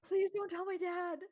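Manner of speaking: high, crying plea; tearful begging. Acted. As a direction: beg through tears not to tell dad. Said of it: "ugly crying".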